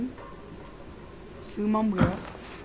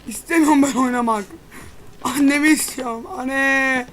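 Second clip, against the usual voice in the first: scared, half-joking wail; scared whine; 6 semitones higher, 8 dB louder than usual. Real, not acted. Terrified player begging to stop.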